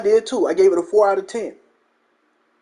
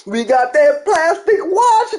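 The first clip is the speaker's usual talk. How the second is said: mock triumphant shout; 6 semitones higher than usual. Streamer parodying a showcase for his homie, mock holler. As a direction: stretch it out high like a gleeful parody punchline. Repeats parody punchline, laughs after.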